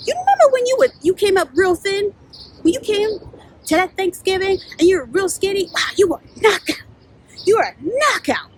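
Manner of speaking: high, loud mock admiration; imitated gushing. Grandmother recalls how thin she once was, high gushing imitation.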